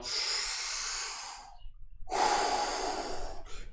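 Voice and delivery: whispered sighing breath out; breathy exhale. Shown as a demonstration. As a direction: blow the breath out audibly. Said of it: "Deep inhale".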